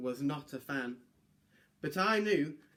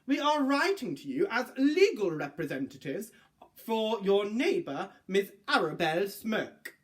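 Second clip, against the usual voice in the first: stiff, indignant formal voice, rising higher; pompous lawyer; 7 semitones higher, 4 dB louder than usual. Acted. Lawyers complaining to Alex about the dragon, pompous and indignant. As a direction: read like a huffy solicitor complaining.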